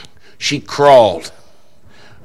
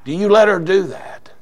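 retold challenge; 7 semitones higher, 4 dB louder than usual. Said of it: "He said".

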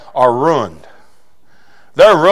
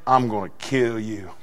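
muttered threat; 9 semitones lower, 13 dB quieter than usual. Retold or imitated. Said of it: "with one of them".

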